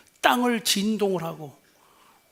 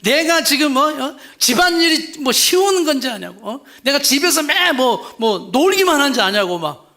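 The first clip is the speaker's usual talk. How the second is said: angry wife; 5 semitones higher, 6 dB louder than usual. Retold or imitated. Wife's angry retort acted.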